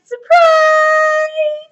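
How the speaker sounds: very high, loud, drawn-out shout; joyful shout. A narrator's reading. Ladies greeting the party guest, shouting. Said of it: "all the ladies shouted".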